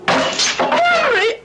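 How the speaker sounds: sudden high alarmed cry; wife cries out as glass smashes; startled cry. Acted. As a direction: shriek his name in shock.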